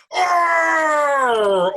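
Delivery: huge high shouted bear roar. Acted. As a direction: roar with all your might, a furious bear. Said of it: "Old Bear roared".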